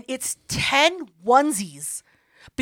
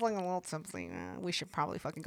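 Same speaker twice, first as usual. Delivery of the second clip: much softer, lower, faster murmur; hushed excuse; 7 semitones lower, 16 dB quieter, faster than usual. Retold or imitated. B J quietly telling hosts they should leave, hushed. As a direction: say it under the breath, discreet.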